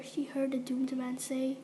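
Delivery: plain reading voice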